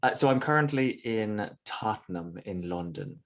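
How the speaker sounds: natural west of Ireland accent; Irish accent. Real, not acted. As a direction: speak in a Mayo Irish accent. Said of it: "You sound very Irish".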